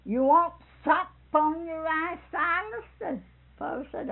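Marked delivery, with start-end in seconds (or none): 0.0-2.8 higher and louder, drawn out and pushy; loud insistent offer. Retold or imitated. Sister Hall pressing Silas at the table, loud and insistent. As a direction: press him hard to take the sop.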